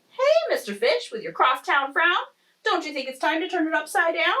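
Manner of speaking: high, chirpy character voice; high-pitched, upbeat clam with a ringing hey; perky clam. Acted. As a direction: pipe up high, coaxing the grump. Cheery clam addresses gloomy fish.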